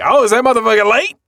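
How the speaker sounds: high, loud, quick incredulous question; mock indignation. Retelling Seiji's reaction to a late friend, mock indignant. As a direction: act out exaggerated outrage at a latecomer.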